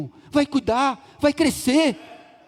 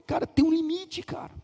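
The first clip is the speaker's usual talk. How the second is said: mocking complaining member; 5 semitones higher, 4 dB quieter than usual. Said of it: "tem gente que fala".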